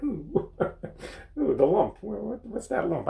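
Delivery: high, hammed-up startled surprise at a lump; mock alarm, voice jumping up high; startled ooh. Imitating himself finding a lump in the shower, startled. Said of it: "and I'd be like".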